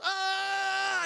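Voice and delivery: high, drawn-out mock attack yell; battle cry. Jack leaps down for the breaking blow, battle cry. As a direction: imitate a warrior yelling mid-leap. Imitates Jack's leaping strike.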